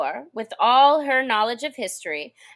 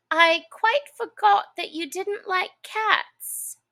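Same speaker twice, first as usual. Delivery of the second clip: put-on higher, slow girlish apology; lightly voiced Alice; 9 semitones higher, slower than usual. A narrator's reading. Alice apologizes to the mouse about cats. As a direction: apologise sweetly.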